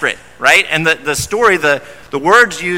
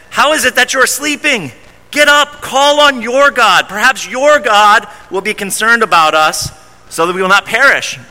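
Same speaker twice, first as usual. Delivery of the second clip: pitched up, urgent plea to get up; frantic captain; 6 semitones higher than usual. Retold or imitated. Captain shaking the sleeping prophet awake, pitched-up panic. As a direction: wake him, panicked.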